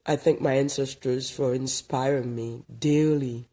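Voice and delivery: low, soft, unhurried thanks to the ancestors; reflective blessing. Acted. Vlogger greeting viewers, giving quiet thanks to ancestors. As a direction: speak gently, counting your blessings. Morning gratitude address, lower and softer.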